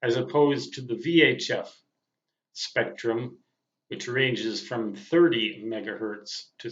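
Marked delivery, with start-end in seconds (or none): none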